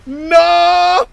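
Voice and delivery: very high, loud, dragged-out wails; anguished cries. Acted. Character seeing his brother fall, anguished slow wailing. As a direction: cry out in shock and despair. Acting shocked character lines.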